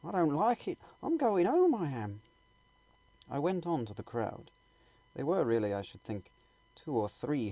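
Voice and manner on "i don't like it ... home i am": high, loud, fretful onlooker backing out; a scared man who wants to go home; nervous bystander. Acted. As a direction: panicky voice, much higher and louder. Frightened bystander wants to leave.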